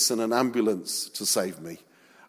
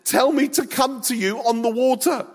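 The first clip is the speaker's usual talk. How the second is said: high-pitched eager plea; excited Peter; 10 semitones higher, 3 dB louder than usual. Acted. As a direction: call out keenly, carried away. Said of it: "in his excitement, he says".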